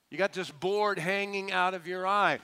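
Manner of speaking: ordinary delivery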